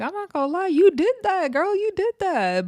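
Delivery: high put-on voice conceding; grudging praise in a mock Daisy voice; sing-song mock of the confessional; imitating Daisy. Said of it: "Daisy is like".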